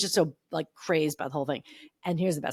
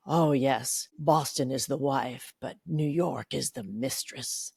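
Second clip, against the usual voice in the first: low, slow, grand diva; Rue McClanahan; 6 semitones lower, much slower than usual. Retold or imitated. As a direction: deliver a worldly line with languid relish. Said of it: "she goes".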